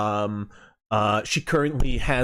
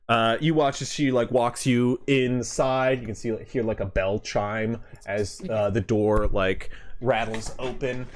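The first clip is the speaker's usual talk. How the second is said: usual speaking voice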